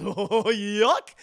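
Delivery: loud whooping "hyuck"; cartoon guffaw, rising at the end; goofy chuckle. Goofy reacting to his date's invitation, loud goofy hyuck.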